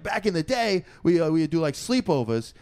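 put-on New York accent; old New Yorker accent. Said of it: "old New Yorker".

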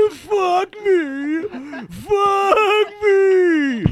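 high, loud wailing shriek of a laugh; worst laugh imaginable. Pretend. Comedian demonstrates the worst possible laugh, shrieking wail. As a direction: shriek it as a horrible mock laugh. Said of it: "your laugh is going to be this".

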